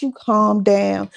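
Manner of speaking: ordinary talk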